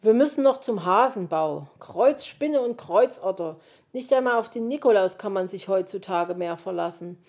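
loud, exasperated fox complaint; grumbling fox. A narrator's reading. Fox laments the vain magpie, loudly grumbling. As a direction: grumble emphatically as a fed-up fox.

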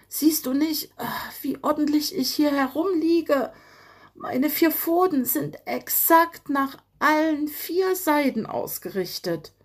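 a winded fox pretending all is in order; lightly voiced fox, mock dignified; dazed fox. A narrator's reading. Fox character voice after crash.

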